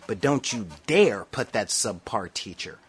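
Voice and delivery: normal talking voice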